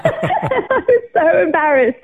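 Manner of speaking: high, drawn-out words through laughter; embarrassed laughter. Real, not acted. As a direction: laugh at your own embarrassment as you speak. Laughing at her own tearful exit.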